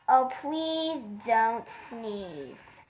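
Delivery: very slow, drawn-out plea; slow begging birds; pleading. Acted. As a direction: stretch every word, begging.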